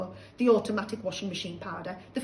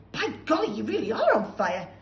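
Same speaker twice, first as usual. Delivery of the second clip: surprised; 6 dB louder than usual. Acted. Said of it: "George said".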